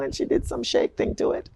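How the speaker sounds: usual speaking voice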